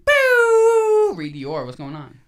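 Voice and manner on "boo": high, loud crowd-style holler; loud welcoming whoop for the guest; hype shout. Real, not acted. Hyping the special guest's arrival.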